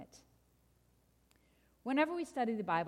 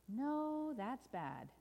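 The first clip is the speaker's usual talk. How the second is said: ominous correction; 4 semitones higher, 4 dB quieter, much slower than usual. Acted. Storyteller overturns good news, sinking gravely. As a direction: let the voice sink in gloom. Story refrain, contradicting the delight.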